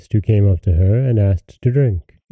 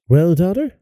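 crying out; 7 semitones higher than usual. A narrator's reading. Mother greeting her returning daughter, crying out. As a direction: call out eagerly in raised pitch. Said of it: "she cried out".

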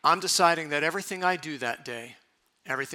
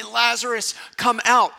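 raised, higher call to summon someone; 6 semitones higher, 7 dB louder than usual. Acted. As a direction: call him out loud by name. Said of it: "gonna call his name".

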